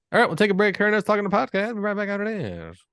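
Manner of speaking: high-pitched, hyped-up host send-off; announcer break throw. Real, not acted. Host sending listeners off to the break, high excited announcer voice. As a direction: call out the break like a radio announcer.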